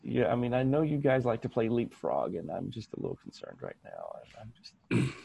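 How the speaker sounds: normal talking voice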